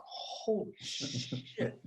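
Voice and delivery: whispered, very slow and soft; awed whisper. Retold or imitated. Overwhelmed client whispering in awe to himself. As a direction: breathe it out in disbelief. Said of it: "I'm just going".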